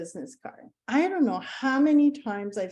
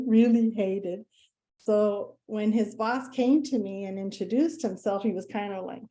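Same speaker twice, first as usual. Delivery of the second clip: ordinary talk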